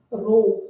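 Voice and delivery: "रोप" with a long held o; long vowel demo. Shown as a demonstration. Teacher showing how we say it, "रोप" with a lengthened o. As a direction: stretch the ओ in "रोप". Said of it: "ऐसे बोला ना".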